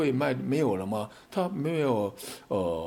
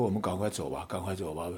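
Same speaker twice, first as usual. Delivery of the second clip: hushed urging; 6 semitones lower, 6 dB quieter than usual. Retold or imitated. Urging wife to flee quietly.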